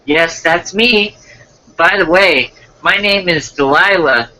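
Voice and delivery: put-on girly voice introducing herself; girl's voice. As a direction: lift your pitch to play the girl. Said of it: "then I'll do the girl's voice".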